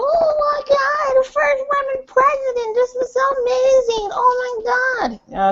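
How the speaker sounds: high, slow gushing squeal; mock a starstruck fan, way up high; high-pitched mocking swoon over the first woman president. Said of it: "people are gonna be there going".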